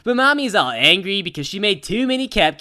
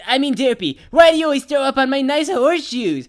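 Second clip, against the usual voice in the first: high, loud exasperated yell; dad's outburst; 6 semitones higher, 4 dB louder than usual. Retold or imitated. Dad yelling at his kid over ruined horseshoes, loud and exasperated. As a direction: blurt it out, fed up and loud. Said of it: "Dad is like".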